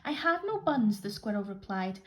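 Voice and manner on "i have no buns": squirrel's reply. Acted. Squirrel answers the rat.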